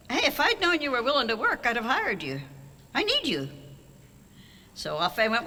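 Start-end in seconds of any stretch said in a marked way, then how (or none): 0.1-3.5 higher, lively imitation of the boss; excited mock boss voice; eager offer. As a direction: pitch it up as the keen boss making the offer. Vic's job offer, retold.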